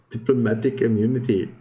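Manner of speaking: lightly voiced South African accent on "Diplomatic immunity". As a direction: have another go at the South African accent, a touch stronger. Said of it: "I can say some things in a bit of a South African accent".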